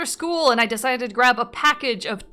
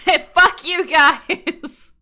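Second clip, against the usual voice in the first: mock indignant; 7 semitones higher, 7 dB louder, much slower than usual. Mock offense at chat teasing.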